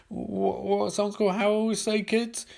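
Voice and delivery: ordinary delivery, nothing marked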